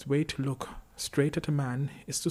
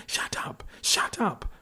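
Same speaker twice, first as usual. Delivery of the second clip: hoarse, restrained scream rising higher; 8 semitones higher, 3 dB louder, slower than usual. A narrator's reading. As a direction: scream it hoarsely, higher and louder the second time. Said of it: "the voice with the hoarse scream".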